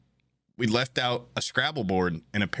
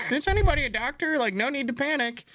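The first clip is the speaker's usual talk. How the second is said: high announcer voice. Retold or imitated. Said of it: "they give a".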